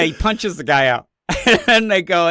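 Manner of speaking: ordinary talk, nothing marked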